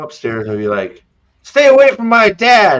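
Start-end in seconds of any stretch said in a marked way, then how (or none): 1.4-2.8 loud, high-pitched protective yell; hammed-up angry shout. Matt comes upstairs to defend his dad, mock furious shout. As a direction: shout it like a melodramatic showdown.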